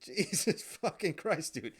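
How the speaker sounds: high, slow shocked exclamation; shocked disbelief. Retold or imitated. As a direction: drag it out in disbelief. Group reacts to the dildo.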